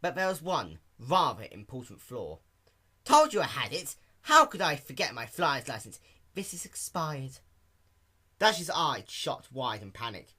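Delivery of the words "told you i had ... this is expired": higher, louder triumphant pony; cocky, then caught out; Rainbow Dash voice. Acted. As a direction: Dash proudly showing the license. Dash shows license.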